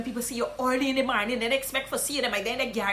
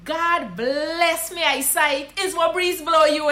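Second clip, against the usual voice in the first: slow, loud Guyanese Creole welcome; Guyanese greeting; 3 semitones higher, 4 dB louder, slower than usual. Shown as a demonstration. Guyanese teacher welcoming a long-absent friend. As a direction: greet them gladly, Guyanese-style. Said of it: "is this one".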